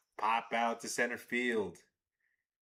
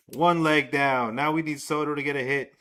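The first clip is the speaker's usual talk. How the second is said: loud hype; 2 semitones higher, 9 dB louder than usual. Real, not acted. Parlay leg won.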